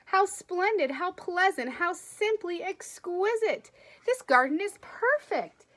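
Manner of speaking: woman enchanted by a garden; delighted admiration. Acted. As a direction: marvel at the flowers. Missus McGee admires the garden.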